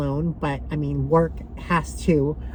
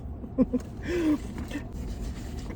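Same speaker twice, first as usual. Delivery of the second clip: breathy laugh through tears; real embarrassed laugh; teary laugh; 9 semitones higher, 10 dB quieter than usual. Real, not acted. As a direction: shake it off with a laugh. After crying over poem.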